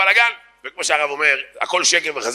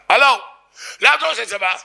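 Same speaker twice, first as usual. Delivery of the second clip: high, sudden demonstrative shout; accosting shout; 7 semitones higher than usual. Shown as a demonstration. Someone shouting at you about the army, aggressive. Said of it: "צועק לך".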